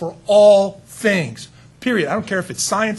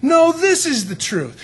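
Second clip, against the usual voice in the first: higher, louder, slow counterclaim; rival claimant; 10 semitones higher, 5 dB louder, slower than usual. Pretend. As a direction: outbid the first one with more force. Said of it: "both said".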